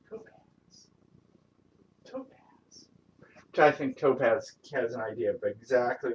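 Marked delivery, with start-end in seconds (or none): 0.1-2.9 whispering a cat's name over and over; whispered calling. Real, not acted. Owner coaxing hiding cat Topaz, whispering her name.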